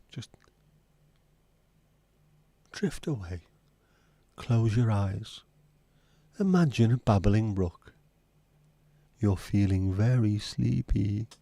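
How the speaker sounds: slow mock hypnosis voice; mock hypnotist. Host jokingly hypnotising a drowsy guest to sleep, slow mock hypnotist. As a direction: lull her to sleep, slow and drawn out.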